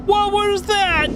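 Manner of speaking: high, slow, stunned asking; shocked disbelief. Real, not acted. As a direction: cry out in shock. Shocked after the crash.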